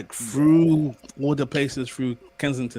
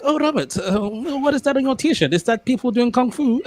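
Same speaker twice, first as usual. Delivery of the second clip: much higher posh South African colleague voice, laughing at the end; posh South African accent; 10 semitones higher, 3 dB louder than usual. Retold or imitated. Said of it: "South Africans have that accent that sounds like they're, like, posh British".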